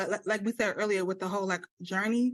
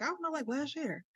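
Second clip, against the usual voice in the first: sheepish mumble; 7 semitones higher, 6 dB quieter than usual. Retold or imitated. Sheepish answer to trainer.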